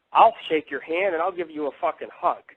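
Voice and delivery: ordinary talk, nothing marked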